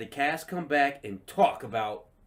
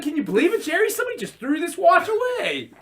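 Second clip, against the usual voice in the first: high, louder amazed uncle; Uncle Leo amazed; 14 semitones higher, 6 dB louder than usual. Retold or imitated. Uncle Leo showing Jerry the watch, high amazement. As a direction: marvel loudly like Uncle Leo. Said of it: "He's like".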